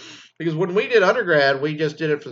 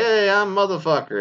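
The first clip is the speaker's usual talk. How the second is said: higher, goofy self-introduction; mock graduate intro; 6 semitones higher, 3 dB louder than usual. Next kid at the mic announcing his name, goofy. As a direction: announce yourself like a clueless kid.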